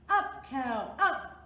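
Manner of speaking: loud, rising urging; cried. A narrator's reading. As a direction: urge the cow skyward. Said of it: "Granddude cried".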